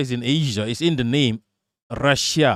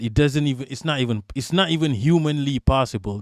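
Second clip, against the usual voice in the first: ordinary delivery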